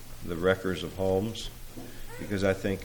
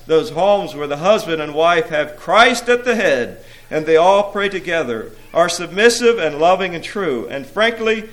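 raised, ringing verse with mounting force; emphatic complaint; 9 semitones higher, 11 dB louder than usual. A narrator's reading. Imps confess failure to the prince, emphatic recitation. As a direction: hammer out each defeat in rhythm. Weary imps admit their defeat.

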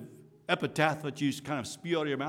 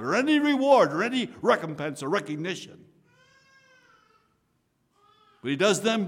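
rising, louder preacher's push; impassioned preaching; 7 semitones higher, 6 dB louder than usual. Real, not acted. Preacher urging the congregation, fervent and raised. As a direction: drive it home from the pulpit.

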